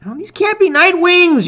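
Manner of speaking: high, loud shout over the noise. A narrator's reading. Hero shouting to friends mid-battle, loud. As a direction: yell it across the fight. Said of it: "you shout".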